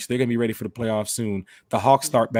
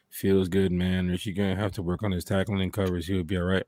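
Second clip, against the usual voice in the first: low laid-back player; 7 semitones lower, 6 dB quieter than usual. Retold or imitated. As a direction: lower relaxed voice. Imagining a player watching.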